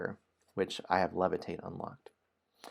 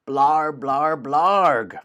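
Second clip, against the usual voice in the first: loud, higher mock ogre shout; gibberish shout; 7 semitones higher, 10 dB louder, much slower than usual. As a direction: bellow nonsense back at the ogre. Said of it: "You shout".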